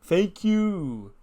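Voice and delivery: a touch of sarcastic gratitude; mock gloating. Gable backing up the ramp, mildly gloating thanks. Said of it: "He's like".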